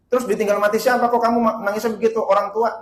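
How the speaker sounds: loud, high, rapid-fire questions; insistent questioning. Acted. Ustadz questioning a crying woman, insistent.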